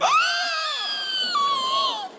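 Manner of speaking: a long, sharp, very high inhaled gasp; huge gasp. Retold or imitated. Uncle Debo gasps in horror at skunks, long high gasp. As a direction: suck in air like you'll never breathe out. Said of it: "He just sort of went".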